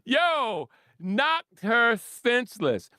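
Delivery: high, slow exclamation; gleeful repeat. Real, not acted. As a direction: drag out each word in high amazement. Repeats knockout punchline after laughing.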